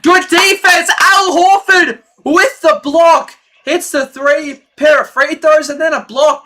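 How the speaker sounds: excited. Real, not acted. Fan streamer cheering his team's block, excited. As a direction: hype the block like a fan on the call. Big play for his team.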